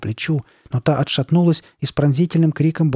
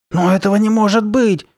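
high, loud cry of disbelief; disbelieving exclamation; 9 semitones higher, 6 dB louder than usual. A narrator's reading. Carly Beth finding no edge, exclaiming. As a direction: exclaim it in shocked denial. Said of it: "воскликнула Карли Бет".

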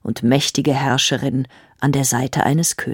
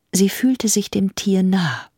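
plain reading voice

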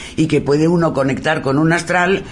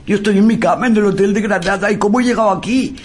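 put-on frightened boy; 9 semitones higher, 3 dB louder, faster than usual. Scared English boy, retold.

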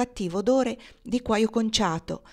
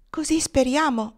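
put-on high, hopeful girlish voice; hopeful young girl; 7 semitones higher, 4 dB louder than usual. A narrator's reading. Blonde girl to her sweetheart, hopeful and high. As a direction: say it like a prayer, pitched high. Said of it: "mormorò la biondina congiungendo le mani".

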